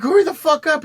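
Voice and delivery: high, loud, panicked hurry-up; mock frantic yell. Dealer yelling at his friend to hurry. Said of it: "He's like".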